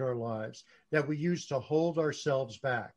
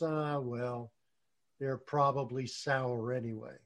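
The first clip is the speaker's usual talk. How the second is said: resigned fox; 3 semitones lower, 7 dB quieter than usual. Retold or imitated. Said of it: "looks at the grapes and says".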